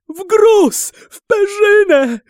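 very high, loud desperate cry; frantic babbling. A narrator's reading. Captive swearing to the sultan, frantic high cry. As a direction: cry it out in a high-pitched frenzy. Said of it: "bełkotał Orbano".

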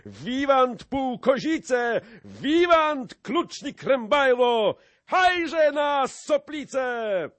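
very high, loud cheers; roaring rallying chant building to a war cry; crowd shouting. A narrator's reading. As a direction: whip up the mob with slow, stretched cries. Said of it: "krzyknęła szlachta".

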